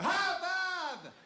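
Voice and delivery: very high, loud relieved laugh-yell; surprised shout. Pretend. Killer realizes the devil was his buddy, shouting. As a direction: burst out in giddy relief. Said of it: "Then you go".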